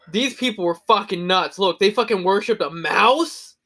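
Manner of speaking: rising disbelief, slowing to a high stretched end; mock-baffled scholar finding a mouse cult; hammed-up shock at the past; amazed future archaeologist. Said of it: "they're gonna act like".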